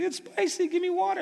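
put-on high, whining child's voice; whiny kid. Father imitating his son begging for water, high whine. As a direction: squeal it like a four-year-old with a burning mouth. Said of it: "He's like".

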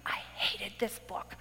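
slow whisper, like a guilty secret; whispered confession. Real, not acted. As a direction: lean in and whisper it. Confessing hatred of the book.

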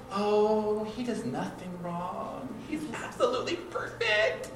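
high, dreamy, mock-adoring gush; gushing fiancée. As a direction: play the smitten bride-to-be, starry-eyed. Gushing fiancée quoted in counseling.

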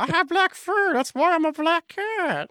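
high squeaky cat voice. Acted. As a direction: talk like a tiny cat. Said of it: "not your cat voice again".

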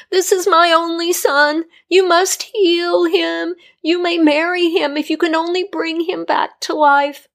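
a desperate mother begging on her knees; pleading queen. A narrator's reading. Queen begging the healer to save her only son, pleading.

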